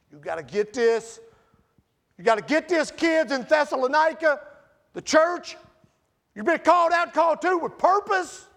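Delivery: rising to a full shout as Paul; impassioned shout. Acted. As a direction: plead with the church at the top of your voice. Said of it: "he's telling them this".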